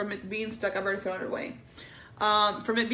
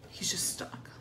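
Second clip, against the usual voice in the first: whisper; 5 semitones lower, 6 dB quieter than usual. Real, not acted. Whispered aside after loud line.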